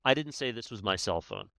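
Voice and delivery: emphasis on the first word I; stress on I. Shown as a demonstration. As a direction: punch "I" in "I didn't say". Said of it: "So I could say".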